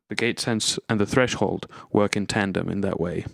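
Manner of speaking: usual speaking voice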